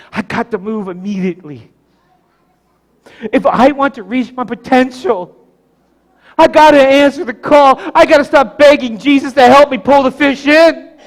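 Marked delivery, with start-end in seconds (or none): 3.3-11.0 raised, loud, driving pulpit voice; preach, urgent. Real, not acted. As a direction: build to a forceful call to act. Preaching at full force.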